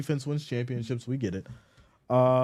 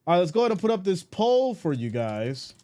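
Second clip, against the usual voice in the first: ordinary talk, nothing marked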